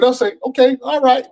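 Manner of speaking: a high, meek okay to the cops; mock surrender, high and light; high resigned okay. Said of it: "I said".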